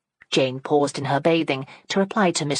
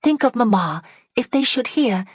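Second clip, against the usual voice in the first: higher, urgent insisting; vehement; 6 semitones higher, 3 dB louder than usual. A narrator's reading. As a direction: push hard to be allowed to leave. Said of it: "said she vehemently".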